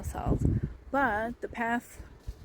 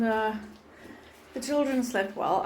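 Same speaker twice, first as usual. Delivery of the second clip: usual speaking voice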